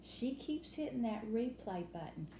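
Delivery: usual speaking voice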